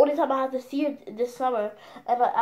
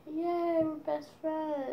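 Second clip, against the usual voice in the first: mock cheer; 4 semitones higher, 8 dB quieter, slower than usual. Retold cheer at making up.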